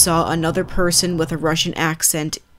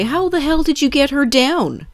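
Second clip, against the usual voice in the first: much higher, stunned disbelief; astonished; 10 semitones higher, 4 dB louder than usual. Retold or imitated. Narrator stunned at the man with the cat, astonished. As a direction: blurt it out in shock. Shocked reply to the stranger.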